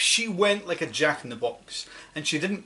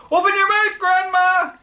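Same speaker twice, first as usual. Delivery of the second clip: much higher, louder urgent call; boy crying out; 10 dB louder than usual. Acted. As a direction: shout the order as you rush in with water. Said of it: "he cried".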